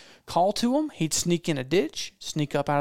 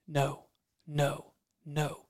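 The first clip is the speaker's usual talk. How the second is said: quiet refusal; 8 dB quieter, much slower than usual. Retold or imitated. Guide refusing the eager hunter.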